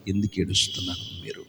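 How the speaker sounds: hushed, low "ఎందుకు ఏడుస్తున్నారు మీరు?" almost whispered; quiet concerned asking; soft question. Acted. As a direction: ask the crying girls gently. Asking crying girls gently.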